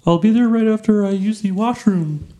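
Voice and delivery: high-pitched Muppet-ish statesman; badly faked president, like Ernie meets Kermit; Abe Lincoln. President excuses himself for the washroom, high Muppet-ish voice. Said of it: "That was Muppet-ish".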